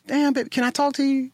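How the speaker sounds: high-pitched mock pickup line; catcaller. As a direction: play a pushy street flirt. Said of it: "affirm that decision by saying".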